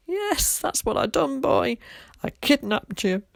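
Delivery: high, laughing admission. A narrator's reading. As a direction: Tom laughing at the boy about the kidnapping, laughing. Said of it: "he burst into laughter".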